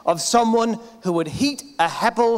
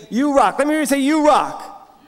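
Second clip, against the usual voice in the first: hype call; 6 semitones higher, 4 dB louder than usual. Real, not acted. Host leads crowd chant.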